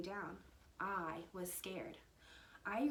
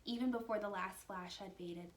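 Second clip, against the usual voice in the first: ordinary delivery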